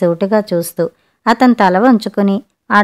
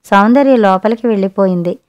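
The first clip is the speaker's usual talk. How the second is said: usual speaking voice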